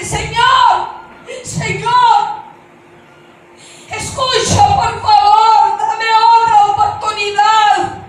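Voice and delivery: loud, high, drawn-out begging cry; shouting to God. Retold or imitated. Woman begging the Lord for another chance, shouted plea. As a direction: cry out desperately for His attention. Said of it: "empiezo a gritarle".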